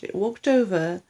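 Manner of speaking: plain reading voice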